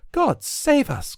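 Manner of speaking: high, slow frightened cry; fright. A narrator's reading. Nancy startled by the thunder, high frightened cry. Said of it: "jumping up in a fright".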